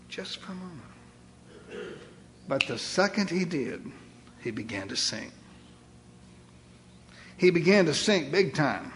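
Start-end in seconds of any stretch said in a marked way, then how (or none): 0.1-2.0 slow, hushed whisper. Real, not acted. Preacher dramatizes Peter's lapse. 2.5-5.3 hushed. Real, not acted. Peter sinking, told dramatically.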